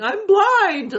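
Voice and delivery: high, loud screamed cry; drunken scream. Retold or imitated. Drunk young woman running downhill screaming. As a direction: scream it out wildly. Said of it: "ran down the hill screaming".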